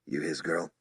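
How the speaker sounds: soft low whispered question. A narrator's reading. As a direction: ask it in a hush. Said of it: "he whispered".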